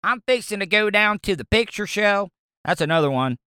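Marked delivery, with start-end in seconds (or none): 0.0-2.3 lightly Southern I'm fixing to; easygoing Southern demo; Southern accent. Shown as a demonstration. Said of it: "you say".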